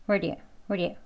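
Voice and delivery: blurred "where do you" with a flicked d. Shown as a demonstration. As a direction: rush "where do you" into two short sounds. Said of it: "더 빨리 발음하면".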